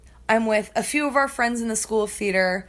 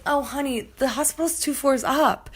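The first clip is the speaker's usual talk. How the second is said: lightly voiced salon lady, somewhat higher; a hint of patronizing sweetness; imitated salon worker; 4 semitones higher than usual. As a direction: mimic an unfazed helper giving directions. Voices salon staff answering.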